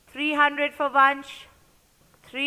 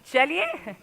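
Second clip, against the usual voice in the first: ordinary talk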